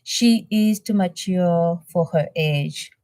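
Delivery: ordinary talk, nothing marked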